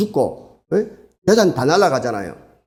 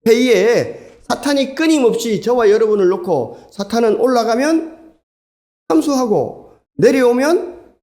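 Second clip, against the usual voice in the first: preaching at full force; 7 semitones higher than usual. Acted. Preacher warning the congregation about Satan's accusations, fervent. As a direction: drive the point home like a revival preacher.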